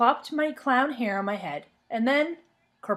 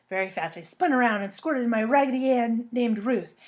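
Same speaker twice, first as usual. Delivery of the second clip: usual speaking voice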